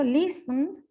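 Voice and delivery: "listen" with the stress on its first syllable. Shown as a demonstration. Teacher showing where the accent falls in "listen", stressed on the first. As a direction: say "listen" leaning on LIS, final syllable unstressed. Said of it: "No recae en la última sílaba".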